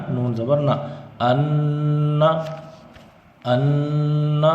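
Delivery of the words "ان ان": ان hummed long through the nose; held nasal ghunna. Shown as a demonstration. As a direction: hold the نون in ان nasally. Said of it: "جیسے حمزہ نون زبر". (ناک میں روک کر ان)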